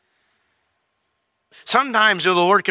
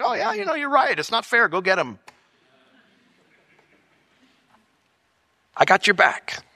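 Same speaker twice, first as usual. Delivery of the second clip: high, breezy mock approval; mock agreeable Lord; 3 semitones higher than usual. Imagined Lord cheering on the preacher's grudge. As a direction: play the God he wishes for.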